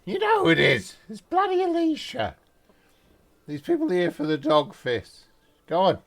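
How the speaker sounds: loud, high shout to someone upstairs; impatient yelling. Acted. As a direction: yell it up, exasperated and familiar. Alicia yelling up to doorkeeper.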